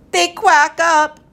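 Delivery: high, loud, drawn-out punchline; gleeful punchline. A narrator's reading. Professor delivers the duck punchline, gleeful. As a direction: land the gag slowly and broadly.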